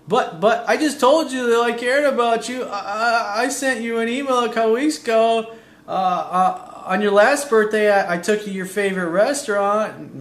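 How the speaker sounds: high, stammering, defensive whine; whiny average guy. Retold or imitated. Average guy justifying himself to his girlfriend, high stammering whine. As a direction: play the flustered guy pleading his case. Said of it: "The average guy is gonna go".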